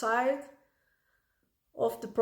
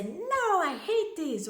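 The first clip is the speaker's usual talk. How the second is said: put-on high, slow cry of a frustrated kid; child venting frustration; mock frustrated child; 6 semitones higher than usual. Shown as a demonstration. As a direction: voice a cross little kid, drawn out. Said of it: "we can say".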